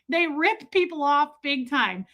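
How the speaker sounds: ordinary delivery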